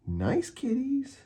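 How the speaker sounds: very high, slow squeal; a frightened villain squealing to tigers, very high. A narrator's reading. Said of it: "squealed Bradley".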